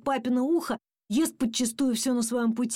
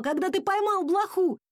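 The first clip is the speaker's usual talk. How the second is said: high-pitched raised question; shouting dad; 9 semitones higher than usual. A narrator's reading. Dad asking son about the flea he caught, shouting. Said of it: "кричал папа".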